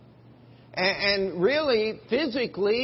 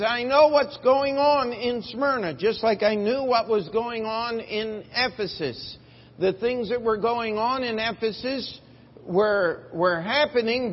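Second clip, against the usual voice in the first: loud, high, rising preacher's cry; full-force preaching; 5 semitones higher, 4 dB louder than usual. Acted.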